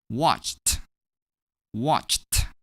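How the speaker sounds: "Watched" in one syllable ending "cht". Shown as a demonstration. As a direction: finish "Watched" on a separately released t. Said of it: "Here's how we pronounce this verb".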